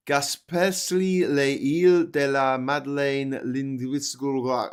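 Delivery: slowly sounding out a French place name; stumbling through a long French name; slow careful French reading. Real, not acted. Reading an election map, slowly sounding out French. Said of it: "Oh, sorry, I can't even".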